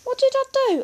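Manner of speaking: very high, loud question; high-pitched boyish protest; protesting innocence. A narrator's reading. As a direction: squeal it in injured innocence. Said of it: "with his mouth full".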